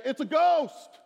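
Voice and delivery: high, loud imitation of frightened disciples; mock terrified cry. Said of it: "you're terrified".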